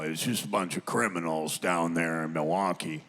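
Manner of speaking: didgeridoo-like low grumble; Uncle Jack. Retold or imitated. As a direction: imitate a disparaging uncle in a rumbling low register. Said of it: "he can get a little snarly".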